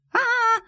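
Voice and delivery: shrill drawn-out squeal; high mouse wail. Acted. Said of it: "продолжал вопить".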